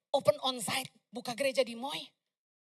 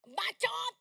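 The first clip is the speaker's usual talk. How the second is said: mocking commenter; 8 semitones higher than usual. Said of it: "banyak yang komen apa?".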